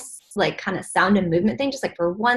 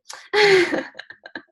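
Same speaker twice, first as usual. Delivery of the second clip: high laugh; 10 semitones higher than usual. Real, not acted. Joke about messed-up hair.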